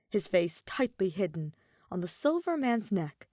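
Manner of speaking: plain reading voice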